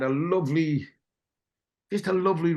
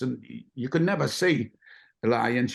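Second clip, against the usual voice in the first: ordinary talk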